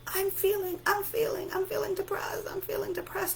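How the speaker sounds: very high, thin pleading voice; mock screaming. Locked-up depression keeps crying out, high mock scream. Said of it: "And it keeps screaming".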